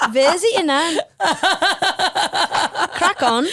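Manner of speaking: laughing, rising mock-British advice; a cheeky Brit telling someone to visit nan; mock British accent. Host mocking advice in a British accent. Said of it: "that accent really".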